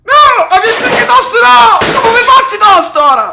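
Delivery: high, loud desperate yelling; panicked screaming. Real, not acted. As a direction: scream to stop the wrecking. Grandson screams as grandpa smashes things.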